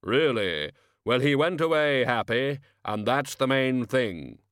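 slow grave voice of Death, cheerfully pleased. Acted. Death chatting to Albert after deliveries, calm and grave. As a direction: speak as Death, mild satisfaction.

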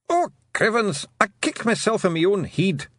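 high Scots exclamation; little Scotsman bewailing his blunder; tiny blue man. A narrator's reading.

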